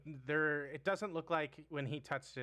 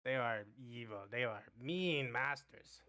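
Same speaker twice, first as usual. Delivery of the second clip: goblin voice. Acted.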